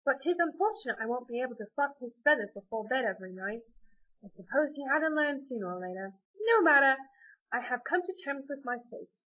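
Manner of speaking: theatrical London turkey gentleman; Gus. Acted. Turkey explaining he is Amy's dinner, London accent. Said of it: "because I'm from London".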